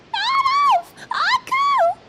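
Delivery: high, loud calling out; desperate scream. Acted. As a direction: scream their names across water. Heroine screams as they're swept away.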